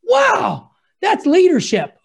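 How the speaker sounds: a high, amazed "wow" falling into admiration. Retold or imitated. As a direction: gasp in admiration. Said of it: "I'm saying to myself".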